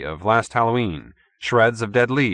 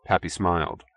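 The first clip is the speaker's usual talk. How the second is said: plain reading voice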